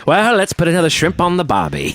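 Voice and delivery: broad Aussie cliché voice; Australian accent. Retold or imitated.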